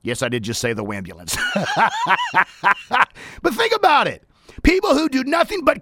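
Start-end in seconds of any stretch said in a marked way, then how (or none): 1.4-3.0 long, high laugh; high-pitched chuckling burst. Real, not acted. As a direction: laugh at your own joke. Laughs at own wambulance joke.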